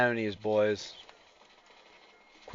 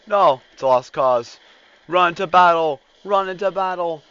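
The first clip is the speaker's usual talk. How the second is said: shouting orders; 9 semitones higher, 16 dB louder than usual. Pretend. Urging troops in battle.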